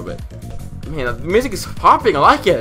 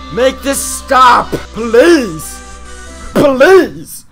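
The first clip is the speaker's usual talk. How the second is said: pleading; 5 semitones higher, 3 dB louder, much slower than usual. Real, not acted. Begging the level to stop.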